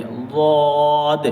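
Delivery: ضاد held long, heavy and thick; drawn-out dad demo. Shown as a demonstration. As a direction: stretch ضاد slowly from the side of the tongue. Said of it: "زبان کی کروٹ".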